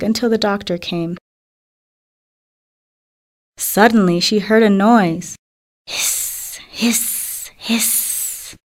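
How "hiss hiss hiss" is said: soft unvoiced snake hissing. A narrator's reading. Narrator hissing the snake's sound to listeners. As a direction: hiss softly like a snake.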